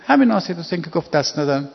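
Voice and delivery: ordinary talk, nothing marked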